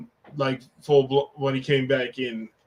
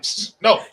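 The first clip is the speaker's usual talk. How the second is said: a sharp hiss then a higher, louder no; spray-bottle psst; 7 semitones higher, 5 dB louder than usual. Pretend. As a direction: shoo a pet with a spray bottle. Spraying an imagined pet.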